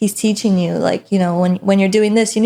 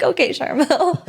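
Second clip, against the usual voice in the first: laughing meek agreement; 7 semitones higher than usual. Retold or imitated. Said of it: "And I'm like".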